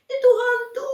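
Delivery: high, loud frightened shout; terrified cry. Acted. Disciples spotting a ghost on the water, terrified cry.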